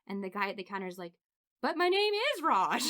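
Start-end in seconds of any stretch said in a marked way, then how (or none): 1.6-2.8 high, puzzled clerk's voice. Retold or imitated. Clerk explaining to the customer, high and bewildered. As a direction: sound baffled, voice pitched up.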